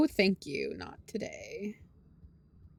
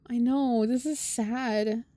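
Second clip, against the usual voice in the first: sad; 3 semitones higher, much slower than usual. Real, not acted. Player moved by sad scene.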